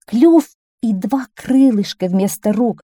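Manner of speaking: ordinary delivery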